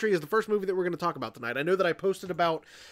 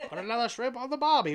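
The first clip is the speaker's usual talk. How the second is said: mock Australian; 6 semitones higher than usual. Jokey Australian impression.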